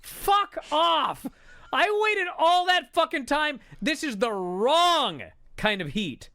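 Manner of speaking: furious, high-pitched yell building from "Fuck you"; retold scream. Fed-up viewer screaming at the wrestling show, furious high yell. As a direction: yell it in loud, drawn-out outrage. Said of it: "I just screamed".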